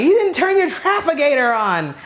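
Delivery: high, exclaimed mock scolding; acted driver's complaint. Acts out chiding a driver.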